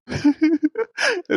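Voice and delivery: high squealing laugh that nearly becomes a cry; helpless high-pitched laugh; burst of laughter. Real, not acted. Granddaughter laughing at grandma's insults, a high squealing laugh.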